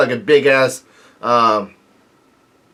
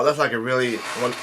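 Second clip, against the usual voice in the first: ordinary talk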